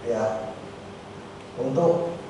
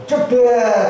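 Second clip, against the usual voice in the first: loud, drawn-out vocal thunderclap; sudden booming sound effect; imitated crash; 7 semitones higher, 4 dB louder than usual. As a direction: burst out a long, high "jeder". Imitated crash as disaster strikes.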